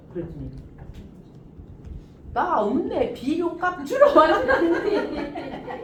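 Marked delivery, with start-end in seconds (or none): 2.3-5.8 slow, laughing Chungcheong country drawl; Chungcheong friend. Retold or imitated. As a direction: play the carefree Chungcheong friend, slowly. Voices friend in dialect.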